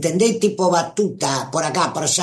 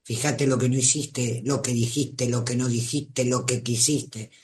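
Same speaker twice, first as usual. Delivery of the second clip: softer, lower, patronising lecture; finger-wagging reproach; 4 semitones lower, 6 dB quieter than usual. Acted. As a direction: scold quietly while wagging a finger. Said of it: "estás con el dedo así".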